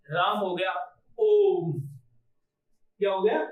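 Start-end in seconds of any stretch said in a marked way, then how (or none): 1.2-1.7 ओम with the ओ held long and a little louder; ओम drawn out like a chant; prolonged vowel. Shown as a demonstration. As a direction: stretch out the ओ in ओम. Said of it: "अब इसे हम कितना भी बड़ा कर सकते हैं".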